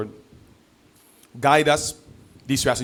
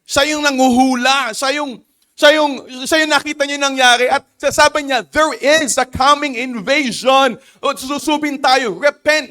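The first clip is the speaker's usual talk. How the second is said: higher and louder, driving sermon voice; fervent preaching; 8 semitones higher, 3 dB louder than usual. Acted. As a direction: press the congregation with urgency. Preaching at full force. (masigasig na pangangaral)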